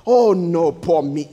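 low, whiny mock self-pity. Preacher mocking a self-pitying believer, low whiny moan. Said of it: "believers don't talk like that".

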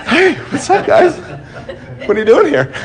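high, laughing "hey guys" to the crowd; hammed-up friendly hello, chuckling; mock cheery greeting. Pastor pretending to greet the Satanists, laughing mock cheer.

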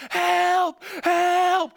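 high, full-volume cry for help; screaming for help. Retold or imitated. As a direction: scream as if about to crash. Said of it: "I start screaming".

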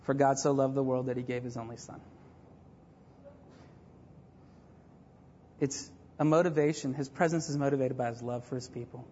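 low, soft, measured preaching; tender, earnest. Real, not acted. Preacher closing on God's love for his people, tender. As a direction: let it settle, low and gentle with pauses.

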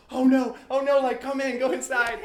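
high, loud panicked concern; alarmed. Retold or imitated. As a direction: rush him inside in alarm. Roommate alarmed at robbery.

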